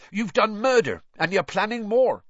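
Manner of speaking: high, loud accusing voice; defiant accusation. A narrator's reading. Intruder confronts master about murder, high and defiant.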